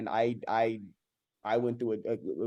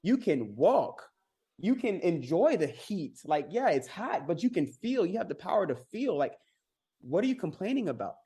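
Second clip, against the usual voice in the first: exasperated pep talk; 6 semitones higher than usual. Retold or imitated. Telling complainers off with rising, exasperated passion. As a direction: shake them awake to how lucky they are. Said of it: "And I'm like".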